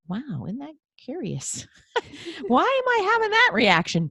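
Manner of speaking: a bright, playful curiosity; curious wonder. Retold or imitated. The host showing how she reframed anger into curiosity. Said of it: "from really angry ... to".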